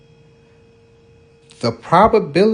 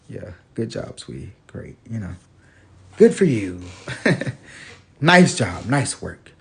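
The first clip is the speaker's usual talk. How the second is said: sleepy humouring; 11 semitones lower than usual. Retold or imitated. Father half asleep humours daughter, low drowsy mumble.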